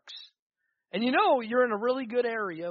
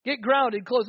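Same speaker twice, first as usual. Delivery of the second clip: louder emphatic repeat; 3 semitones higher, 8 dB louder, slower than usual. Real, not acted. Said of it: "You need to get grounded".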